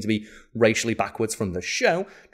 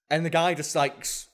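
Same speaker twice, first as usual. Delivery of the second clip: normal talking voice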